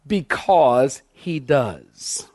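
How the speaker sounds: very slow, each word set apart; slow, deliberate answer. Shown as a demonstration. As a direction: drag out every word of it slowly. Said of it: "I mean, really, why does God love me?".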